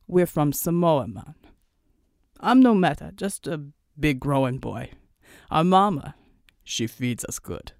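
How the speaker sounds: friendly giant from Samoa, dropping low at the ends; big Samoan islander. Acted. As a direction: play an easygoing island big man. Samoan islander jokes about size.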